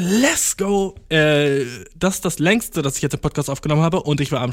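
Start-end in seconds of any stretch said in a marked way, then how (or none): 0.0-1.0 hyped. Real, not acted. Hyped catchphrase.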